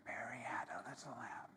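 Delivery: soft unvoiced whisper of the rhyme. Shown as a demonstration. Yoga teacher demonstrating a whisper to the class. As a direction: breathe the words out with no voice.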